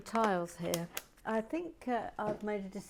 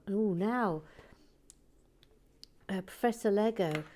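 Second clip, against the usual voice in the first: ordinary delivery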